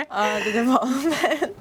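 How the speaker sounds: laughing through the words; giggling at her own slip. Real, not acted. Guest laughs at forgetting an English word, giggling.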